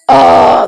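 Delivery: loud, crying groan; pained cry. Real, not acted. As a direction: let the frustration break out. Venting about her kids.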